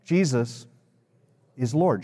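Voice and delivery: long pause after Jesus, then "is Lord"; leading group recitation. Shown as a demonstration. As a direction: give them Jesus, wait, finish evenly. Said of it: "Would you say that with me?".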